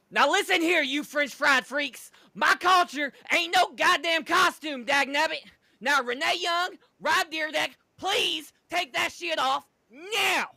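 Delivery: high, loud yelling country rant. Acted. Jamie Noble scolds La Resistance, furious country shout. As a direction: holler it like an angry hillbilly. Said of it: "the tone of his voice".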